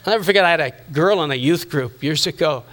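usual speaking voice

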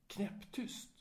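breathless whisper on the silent word. A narrator's reading. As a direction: let the word fall into silence. Dead-silent restaurant described.

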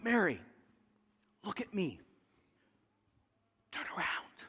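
hushed whisper. Retold or imitated. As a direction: breathe it softly to her. Angels coaxing Mary gently.

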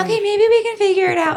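very high, loud squeaky giving-in; mock placating. Giving in to a begging boyfriend, mock placating. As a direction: humor them in an exaggerated high voice.

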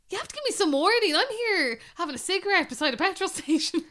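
high, loud flustered outburst from mum; mum caught off guard. Retold or imitated. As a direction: mum yelping, caught without warning. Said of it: "Her mum was like".

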